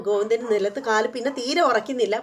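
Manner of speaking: ordinary talk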